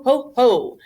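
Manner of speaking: ordinary delivery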